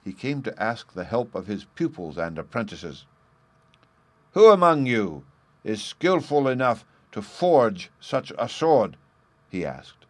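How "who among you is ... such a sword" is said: raised, higher voice, opening loud on the question; loud challenge. Acted. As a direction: put it to the whole room like a master's challenge. Master challenges his apprentices aloud.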